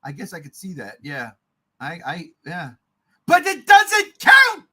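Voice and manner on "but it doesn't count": higher and louder, slow and emphatic; loud insistence. Real, not acted. Host arguing with his co-host, loud and insistent. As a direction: hammer home that it doesn't count. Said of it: "insisting loudly".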